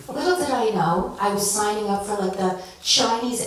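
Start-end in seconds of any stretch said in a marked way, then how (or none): none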